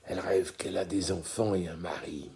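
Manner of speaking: quiet low murmur. A narrator's reading. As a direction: say it under the breath.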